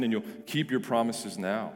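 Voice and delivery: normal talking voice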